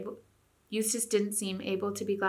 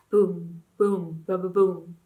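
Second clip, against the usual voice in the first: booming drumbeats voiced; drum imitation; 5 dB louder, much slower than usual. A narrator's reading. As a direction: beat out the drum with your voice.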